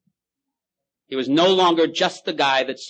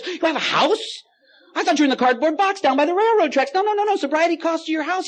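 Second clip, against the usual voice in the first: mocking God; 6 dB louder than usual. God mocking the drunk's house, much higher. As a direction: tease him about the cardboard box. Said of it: "He says".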